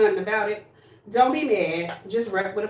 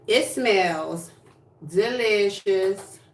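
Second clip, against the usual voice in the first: drawn-out delicious; much slower than usual. Real, not acted. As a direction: stretch out delicious slowly, savoring it. Savoring her new scent.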